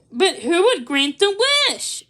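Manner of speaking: exclaim. A narrator's reading. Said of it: "Missus Claus exclaimed".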